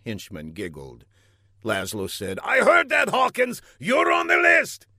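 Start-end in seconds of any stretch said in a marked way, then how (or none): 2.4-4.9 high, loud outburst; furious villain. A narrator's reading. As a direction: shout a threat at the henchman. Villain threatens giggling henchman.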